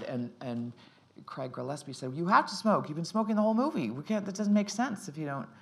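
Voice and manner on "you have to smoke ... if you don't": louder and higher, firm insistence; the director insisting she must smoke; insistent director. Retold or imitated.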